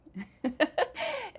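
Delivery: high, breaking giggle; real burst of high laughter; amused laugh. Real, not acted. Teacher amused by her own accusing example, high laugh.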